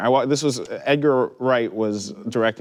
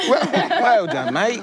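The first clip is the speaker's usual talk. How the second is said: high, hammed-up British; playful mock British; British accent; 10 semitones higher than usual. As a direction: put on a matey British accent, pitched up. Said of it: "he's doing a British accent".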